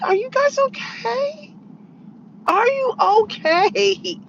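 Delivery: high, slow, drawn-out "are you guys okay"; sing-song sarcastic concern, high and slow; mock concern. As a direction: pretend worry, really mocking them.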